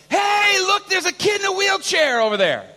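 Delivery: high, loud call across a square; excited shout. Retold or imitated. Son to family outside the basilica, excited shout. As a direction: shout out what you've spotted. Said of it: "er schreit da".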